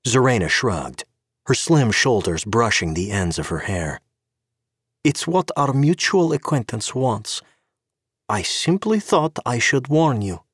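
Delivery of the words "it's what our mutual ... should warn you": a lightly higher, cool woman's voice; Zarena's voice. Acted. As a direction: play the composed spy. Zarena speaks in character.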